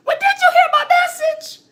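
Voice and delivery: very high crying voice with laughter; imitating her own TV crying, pleading and high; mock tearful plea. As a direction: wail it through fake tears. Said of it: "I'm like".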